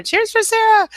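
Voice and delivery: very high, loud cheer; shrieking cheer. Real, not acted. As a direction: whoop it for her. Leading cheers.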